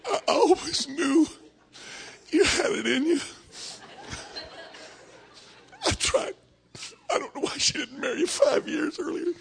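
high-pitched, tearful, hammed-up gushing; Myrtle. Preacher acting out a woman fawning over Jethro, high and tearful. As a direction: gush in a high, weepy put-on voice. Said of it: "here comes Myrtle".

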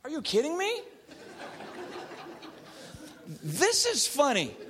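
laughing through the words in disbelief; can't hold back the laugh. Retold or imitated. Therapist to angry husband, laughing it off. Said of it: "And I'm like".

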